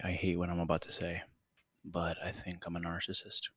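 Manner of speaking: hushed confession; quiet. Real, not acted.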